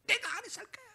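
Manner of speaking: put-on high pitched petulant child; a hint of a sulky teen; defiant child. Child brushing off a parent, high and petulant.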